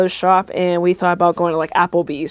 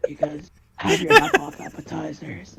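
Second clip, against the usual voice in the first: put-on soft, high-pitched old man voice; disguised old man; 7 semitones higher, 5 dB quieter than usual. Caller repeating the question to Applebee's, disguised old man. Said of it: "disguise my voice as an old man".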